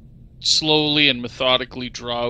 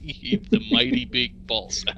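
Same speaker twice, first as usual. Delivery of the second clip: higher, mock-grand naming; mock awe; 9 semitones higher than usual. Player mocks the goblin's boss, higher and mock-grand. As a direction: puff the name up.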